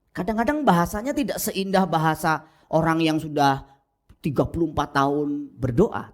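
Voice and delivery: normal talking voice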